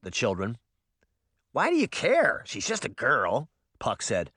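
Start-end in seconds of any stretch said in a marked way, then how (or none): 1.5-3.5 put-on high, dismissive kid voice; lightly voiced scornful boy; scoffing boy. A narrator's reading. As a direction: scoff like a grossed-out little boy. Boy Puck scoffs at romance.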